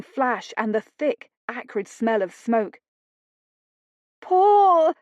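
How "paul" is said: much higher, loud call; wife crying out for her husband; desperate cry. Acted. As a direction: cry out for help. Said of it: "she called out".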